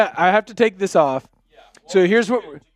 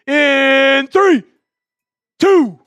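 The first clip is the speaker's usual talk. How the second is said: hyped countdown; 5 semitones higher, 8 dB louder, much slower than usual. Real, not acted. Countdown to pick raffle winner.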